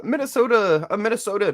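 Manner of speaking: high sing-song Minnesota accent on "Minnesota"; mock Upper Midwest "Minnesota". Shown as a demonstration. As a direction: stretch "Minnesota" the Minnesota way, pitch up. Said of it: "sounded a little bit the same".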